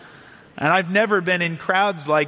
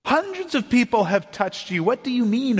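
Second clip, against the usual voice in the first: higher, incredulous disciples scoffing at Jesus; mocking disbelief; 5 semitones higher than usual. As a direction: scoff at the question as if it were absurd. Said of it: "the mockery or confusion in their voice".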